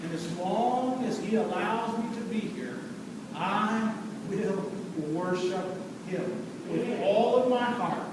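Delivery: low, soft, measured declaration; solemn vow. Real, not acted. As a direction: bring it down to a quiet, heartfelt pledge.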